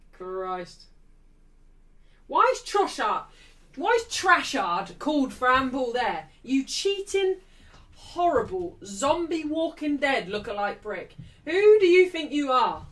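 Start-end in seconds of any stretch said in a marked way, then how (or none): 2.3-12.9 loud, raised fan tirade; real indignation, rising to a loud last question; outraged rant. Real, not acted. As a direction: hurl abuse at the player on screen. Ranting at a player mid-match.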